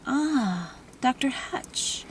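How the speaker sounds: put-on slow, breathy French-accented greeting; light French accent, drawn out. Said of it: "he said with a French accent".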